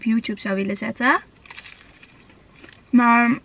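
talking between bites of food; eating while speaking; mouth full chewing. Real, not acted. Seen 3: Bites nuggets repeatedly and chews through the labelled words.

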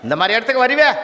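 high, loud scolding shout at her son; a mother yelling at her boy in front of the crowd. Retold or imitated. Mother yells at her son in front of the judge, high loud scolding.

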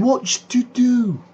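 put-on high, slow manager voice in Lancashire accent; manager's voice. Manager asks the parents in high slow Lancashire voice. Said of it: "He came and he said".

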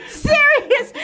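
high startled yelp of disbelief; startled cry. Real, not acted. Child found while hiding, startled yelp. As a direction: shriek it out, caught off guard. Kid startled in hide and seek.

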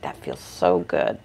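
low, slow, blissed-out dog voice; voicing the dog. Groomer voicing the dog enjoying his ear cleaning, low slow voice. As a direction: drop way down and drawl out his contentment. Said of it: "oh, he's like".

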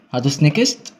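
usual speaking voice

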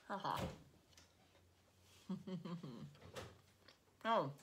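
chewing while laughing; muffled through food; laughing with mouth full. Real, not acted. Seen 0: Bites meatball off fork at 0.1, then chews through laughter.

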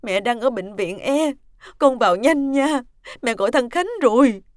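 frantic and pleading, at high volume; panicked urgency. Acted. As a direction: urge them to hurry to the hospital. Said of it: "giọng bà Liễu vẫn vang lên".

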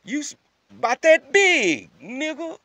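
high, loud, drawn-out exclamation; high-pitched incredulous punchline; comic disbelief. Real, not acted. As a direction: stretch it out in loud amazement. Comic disbelief at baby size.

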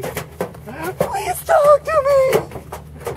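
very slow, squeaky-high begging; high pleading wail. Acted. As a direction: stretch the plea into a high wail. Drawn-out plea to ducks.

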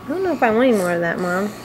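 fast, lightly voiced baby talking back; voicing the baby. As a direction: rattle it off as the fussy baby. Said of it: "She's like".

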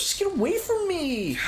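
put-on high whiny kid voice; whiny tattling little sibling. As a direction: whine like a bratty little sibling. Kids bickering in back seat.

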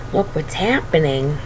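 ordinary delivery, nothing marked

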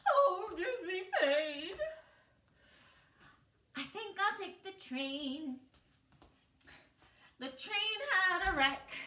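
dramatic. Shown as a demonstration. Teacher hamming up the pie chant for the class. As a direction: lay the drama on thick. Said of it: "let's really lay it on thick".

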